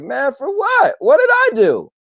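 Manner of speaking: high, baffled protest; incredulous disbelief. Retold or imitated. Retelling his reply to Ray, asking what he did, incredulous. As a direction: pitch it up in disbelief, like you did nothing wrong.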